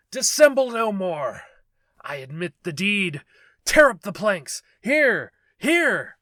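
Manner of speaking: high, very loud shriek. Acted. Killer breaking before the officers, shrieking. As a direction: scream it at them.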